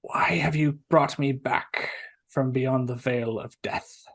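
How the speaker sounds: slow, hushed voice from the dead; revived corpse. Acted. Corpse asking why it was woken, slow undead voice. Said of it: "its mouth starts to move".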